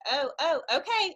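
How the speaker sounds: high, loud, startled okay; acted-out hesitant yes; flustered agreement.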